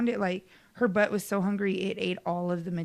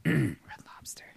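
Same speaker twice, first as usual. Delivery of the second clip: whisper; 7 semitones lower, 4 dB quieter than usual. Real, not acted. Inside joke whispered.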